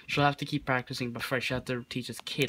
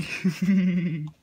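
laugh; 5 semitones higher, 5 dB louder than usual. Real, not acted. Laughing at title.

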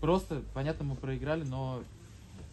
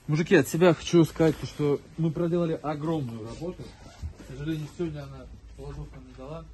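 loud rallying address to the team; locker room speech; 8 dB louder than usual. Real, not acted. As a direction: open big and loud to the lads. Coach addresses team after loss. (громкая речь тренера перед командой)

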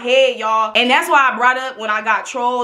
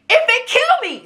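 dramatic high cry; 13 semitones higher than usual. Real, not acted. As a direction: voice jumps way up high. Dramatic vow.

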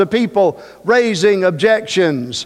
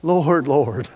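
Jeremiah lamenting; 6 semitones lower, much slower than usual. Acted. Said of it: "Jeremiah said something like this".

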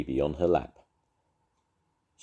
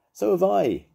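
rival voice; 7 dB louder than usual. A narrator's reading. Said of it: "came a voice".

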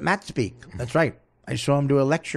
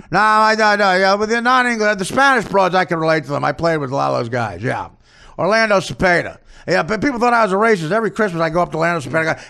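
loud, higher caricature easing off between bursts; Don Zimmer; 5 semitones higher, 5 dB louder than usual. Acted. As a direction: bluster defensively in a hammed-up coach voice. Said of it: "it's Don Zimmer".

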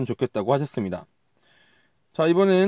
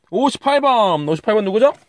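excited reveal; 6 semitones higher, 5 dB louder than usual. Real, not acted. Winner number revealed.

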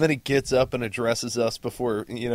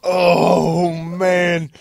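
loud, high drawn-out groan; real dismayed outburst; 9 semitones higher, 12 dB louder than usual. Real, not acted. Listener hears the poser's lame excuse, loudly appalled. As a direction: react with a big pained wail.

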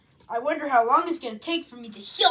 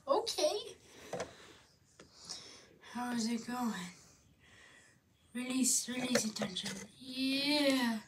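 soothing; 3 semitones lower, 14 dB quieter than usual. Acted. Soothing massage and healing.

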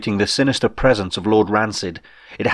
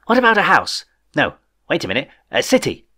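higher, eager rush of questions; bubbling excitement; 7 semitones higher than usual. Acted. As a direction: spill over with childish glee. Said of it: "frothing over with excitement".

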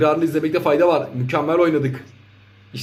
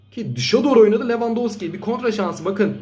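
usual speaking voice

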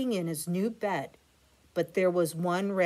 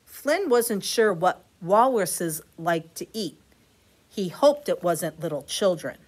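ordinary delivery, nothing marked

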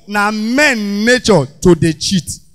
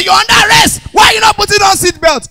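high, loud police yell; angry police shout; 10 semitones higher, 4 dB louder than usual. Retold or imitated.

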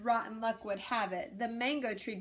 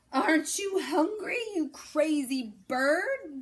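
exasperated mayor wailing at the stubborn bird; 7 semitones higher, 8 dB louder than usual. A narrator's reading. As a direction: wail it in exasperation. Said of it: "wailed Don Pepe".

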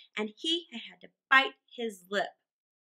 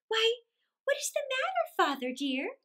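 put-on very high, girlish question; little girl's voice; 12 semitones higher than usual. A narrator's reading. Daughter asks her upset father, high girlish voice. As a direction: lilt it high like a small daughter.

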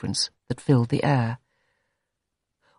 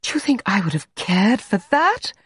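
passionate; 6 semitones higher than usual. Acted. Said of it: "She interrupted him passionately".

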